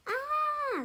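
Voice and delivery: very high, loud scream; falling scream. A narrator's reading. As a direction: yelp as she falls in the river. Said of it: "Sage cried out".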